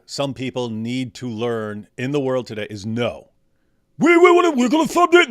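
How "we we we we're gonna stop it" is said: high, loud mock speech voice; mock pompous leader. Mocking a pompous leader's promise, loud and high. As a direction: ham up a grandstanding politician's promise.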